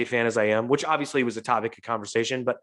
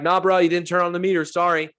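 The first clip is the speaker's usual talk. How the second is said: higher, loud brush-off to the cabbie; retold defiant retort; 7 semitones higher, 5 dB louder than usual. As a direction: call it back over his yelling. Arguing back at yelling cabbie.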